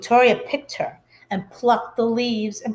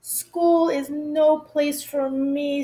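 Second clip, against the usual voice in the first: put-on slow, sad little girl; girl telling parents, slow and sad; dejected child; 4 semitones higher, slower than usual. A narrator's reading. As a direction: drag it out, downcast. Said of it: "said Chrysanthemum".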